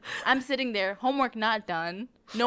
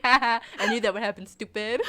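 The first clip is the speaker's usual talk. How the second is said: louder, fast mock-gloating tease; teasing grandpa; 8 dB louder than usual. Friend voicing the grandpa gloating over the ruined book, fast and loud. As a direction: rub it in like a smug grandpa.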